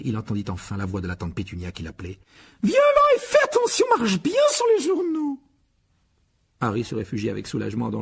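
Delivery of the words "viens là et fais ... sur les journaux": very high, loud bossy woman; piercing aunt's command; shrill aunt calling. A narrator's reading. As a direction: bark orders from the kitchen. Aunt Petunia calling Harry.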